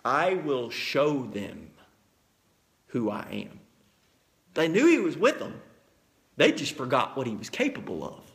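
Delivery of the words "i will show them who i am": much lower, slow and softer; voice of God. Retold or imitated. Preacher voices God answering Moses, low and slow. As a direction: let it sink low and slow, like God speaking. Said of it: "God said".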